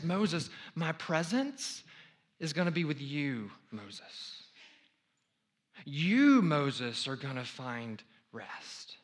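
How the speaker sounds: slow, pointed "Moses ... You, Moses"; stress on you. Shown as a demonstration. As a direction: hit "You" to show it is singular. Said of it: "you in the singular".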